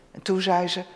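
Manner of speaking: normal talking voice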